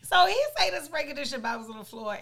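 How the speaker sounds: ordinary talk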